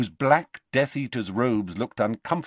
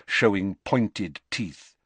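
plain reading voice

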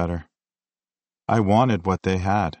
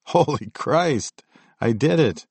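exclaiming; 6 semitones higher, slower than usual. Retold or imitated. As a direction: burst out in joyful disbelief. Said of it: "I exclaimed".

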